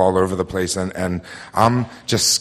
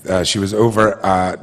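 ordinary talk, nothing marked

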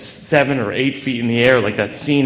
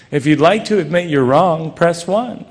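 higher, cheery recorded-menu voice; automated phone menu; 6 semitones higher, 4 dB louder than usual. Retold or imitated. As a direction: read it like a hotline recording. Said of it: "And she'd say".

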